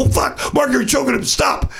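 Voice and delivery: alarmed plea. Retold or imitated. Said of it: "They go".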